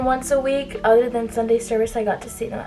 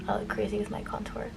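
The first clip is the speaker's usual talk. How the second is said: soft unvoiced whisper; whispered aside; 10 dB quieter than usual. Real, not acted. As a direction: say it under your breath. Aside while doing makeup.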